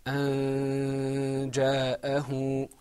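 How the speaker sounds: "أن جاءه" very slow, n held as a long hum; with ikhfa. Shown as a demonstration. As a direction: chant "أن جاءه" on one level note, n hidden. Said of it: "With ikhfa, it's pronounced".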